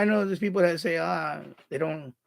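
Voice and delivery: ordinary delivery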